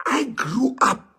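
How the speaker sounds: high, crying, barely holding it together; a real tearful catch; choked up. Real, not acted. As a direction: fight back a sob on the words. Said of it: "I'm even getting emotional".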